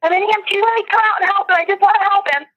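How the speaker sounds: high, urgent plea without full volume; retold scream. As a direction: re-enact a scream, pitched well up. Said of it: "then I screamed".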